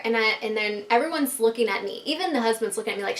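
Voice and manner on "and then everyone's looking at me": usual speaking voice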